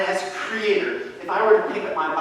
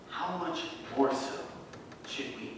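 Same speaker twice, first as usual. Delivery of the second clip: much softer, lower rhetorical question; hushed challenge; 5 semitones lower, 11 dB quieter than usual. Real, not acted. Pastor presses the question on his church, hushed. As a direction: drop to a near whisper for "should we".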